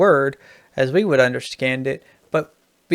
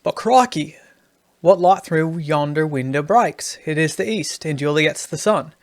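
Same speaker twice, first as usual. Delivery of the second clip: put-on Aussie vowels, mildly hammed; Australian accent. Shown as a demonstration. Writing teacher demonstrating the Australian version of the line, Australian accent.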